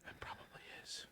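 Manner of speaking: unvoiced hushed whisper. Real, not acted. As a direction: whisper it aside. Whispered aside.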